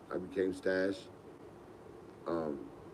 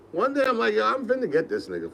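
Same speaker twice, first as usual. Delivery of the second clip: much higher, louder scheming; excited; 12 semitones higher, 4 dB louder than usual. Retold or imitated. Planning a prank on Tug, higher excited voice. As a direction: hype yourself up for a prank.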